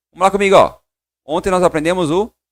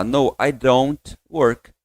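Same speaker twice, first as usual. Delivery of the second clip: very slow, evenly spaced words; measured pace; 2 semitones lower, much slower than usual. Shown as a demonstration. Teacher shows the careful, spaced-out way, very slow. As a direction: spell out each word at a measured pace. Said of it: "Se você fala mais compassado".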